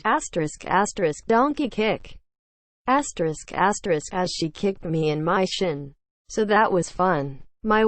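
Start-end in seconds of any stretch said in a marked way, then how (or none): none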